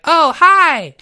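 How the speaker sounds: high, loud "Oh, hi" greeting; chirpy pun greeting shouted high. Pretend. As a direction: belt out "Oh, hi" bright and high. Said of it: "You're like".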